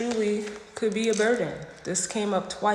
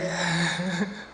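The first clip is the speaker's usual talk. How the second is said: laugh; 4 dB quieter than usual. Real, not acted. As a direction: chuckle quietly to yourself. Laughs after urging the client.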